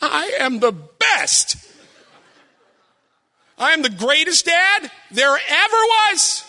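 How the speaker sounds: high, drawn-out gloating; hammed-up triumphant self-praise; boastful proud dad. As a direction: crow it slowly like a champion.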